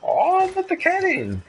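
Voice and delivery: high, whiny plea for the cat; high pleading whine. Pretend. Friend pleads with streamer to let the cat in, in a high whine. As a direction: beg in a high silly voice.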